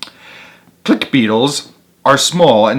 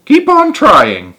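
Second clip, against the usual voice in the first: higher, gentle encouragement; kindly turtle; 9 semitones higher, 5 dB louder, slower than usual. A narrator's reading. Turtle passing by encourages the beetle, high and gentle.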